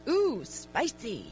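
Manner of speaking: slow, drawn-out appreciative ooh; mock relish. Man tasting a spicy sandwich in an ad, slow mock relish. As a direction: stretch it out, savoring the heat. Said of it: "and he goes".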